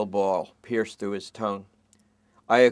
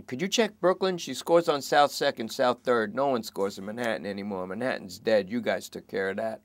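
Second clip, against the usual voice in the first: begging suspect. Acted. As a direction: beg them to check. Lester pleading.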